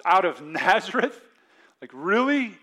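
incredulous question, "Nazareth" punched loud and rising; skeptical. Acted. Preacher voices Nathanael doubting his friend's claim, loudly skeptical. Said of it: "Nathanael's skeptical".

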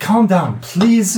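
lightly acted plea to settle; pleading to calm.

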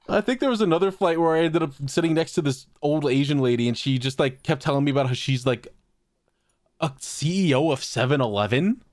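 normal talking voice

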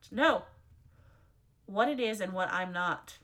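ordinary talk, nothing marked

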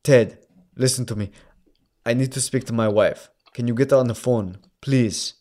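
put-on firm, restrained Austrian-accented demand. Husband insisting to speak to his wife, Austrian accent. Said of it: "I have to do this motherfucking accent".

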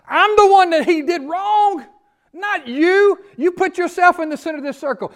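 the wronged father. Acted. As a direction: cry it out as the father who was hurt most. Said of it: "here's the father".

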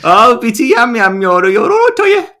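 loud, very high mock Brummie; Birmingham fan accent. Joking as a Birmingham City fan, shouted Brummie chant. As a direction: belt it out as a caricature Birmingham fan. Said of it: "sounds like s-, uh, Birmingham City fan".

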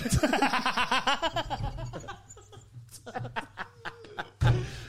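loud high cackling laugh; big burst of laughter. Real, not acted. Howling with laughter at a friend's slip. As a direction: let a huge laugh burst out.

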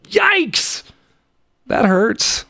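high squeaky yelp of dismay; yikes. Real, not acted. A man realizing how long he has been jobless, high yelp. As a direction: squeal it in shock. Realizes unemployed 156 days.

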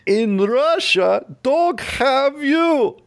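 put-on high, drawn-out Russian accent; hammy Russian stand-up accent; Yakov Smirnoff. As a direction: land the punchline in slow mock Russian accent. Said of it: "Yakov Smirnoff".

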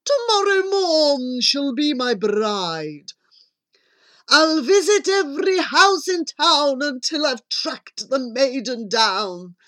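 declaiming, lovestruck prince; prince's proclamation. Acted. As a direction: proclaim it as a theatrical vow. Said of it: "he cried".

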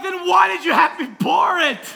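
high, loud shouted comeback; mock exasperated yell. Dad who poured unwanted milk, mock exasperated yell. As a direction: yell it as the fed-up parent.

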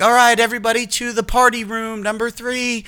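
high, bright host announcement; mock party host. Party host sending everybody to party room, high announcement.

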